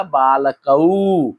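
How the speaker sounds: बालकऊ very slowly, "baa-la-ka-oo" with a long ending; drawn-out dual ending. Shown as a demonstration. Teacher showing how two boys changes बालकऊ, drawn-out ending. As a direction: stretch the final "oo" of बालकऊ. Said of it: "क्या हो गया?".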